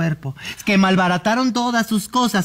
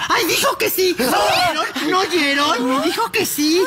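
excited; 9 semitones higher than usual. Acted. Claims spirit answered.

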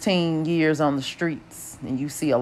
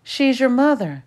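nurse voice; 9 semitones higher than usual. A narrator's reading.

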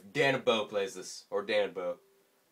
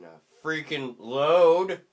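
ordinary delivery

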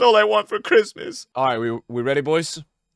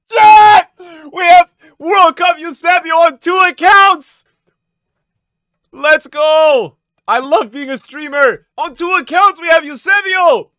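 high, loud, ecstatic yelling; voice-losing celebration shout; 7 semitones higher, 9 dB louder than usual. Real, not acted. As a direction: shout until the voice gives out. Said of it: "I just lost my voice".

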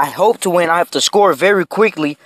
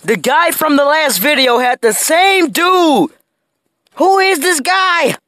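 higher, exasperated ranting; frustrated rant; 7 semitones higher than usual. Real, not acted. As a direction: complain at the screen, fed up. Ranting about opponent's player.

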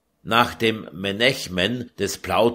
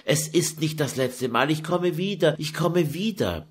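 pleading; 8 semitones higher than usual. Acted. Daughter reassures her mother.

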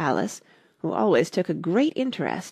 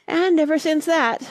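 high, loud, plaintive lament; mournful; 11 semitones higher, 6 dB louder than usual. A narrator's reading. Hatter laments his quarrel with Time, mournfully. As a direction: wail it like an old grievance. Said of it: "went on in a mournful tone".

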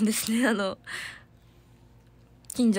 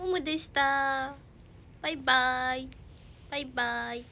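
a hint of a pet voice; 3 semitones higher, 4 dB quieter than usual. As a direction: sign off as the dog, sweetly. Speaking as the dog.

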